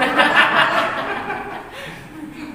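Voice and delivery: a high, open laugh at his own joke; hearty laugh. Real, not acted. Preacher laughing with the congregation after telling wives to pray, hearty laugh. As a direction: laugh along with the church.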